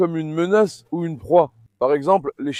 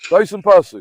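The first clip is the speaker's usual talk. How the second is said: firm command; 3 semitones higher, 8 dB louder than usual. Real, not acted. Commanding the dog.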